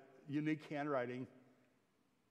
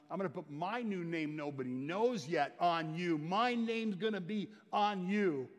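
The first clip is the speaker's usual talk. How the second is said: rising proclamation; 5 semitones higher, 6 dB louder than usual. Acted. Preacher voicing Jesus' promise.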